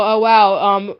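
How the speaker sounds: ordinary talk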